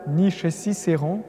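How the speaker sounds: ordinary talk, nothing marked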